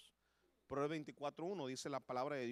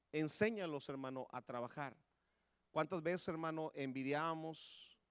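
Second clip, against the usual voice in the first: forceful preaching; 6 dB louder than usual. Acted. Preacher urging parents to teach children work, loud and forceful. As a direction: drive the lesson home hard. Exhorting on the verse.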